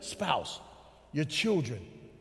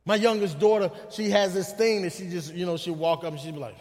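ordinary talk, nothing marked

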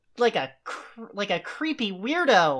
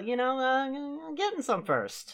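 hushed aside; 2 semitones higher, 9 dB quieter, slower than usual. Pretend. Awkward hushed punchline.

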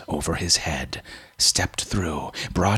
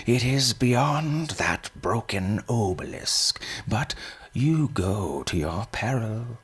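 put-on old man voice; 6 semitones higher than usual.

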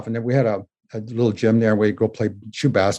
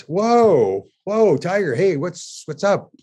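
high, drawn-out whoas, easing off; retold surprised whoa to a child; startled but friendly whoa; 7 semitones higher, much slower than usual. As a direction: fend off a kicking kid, keep it friendly. Calming a kicking eight-year-old.

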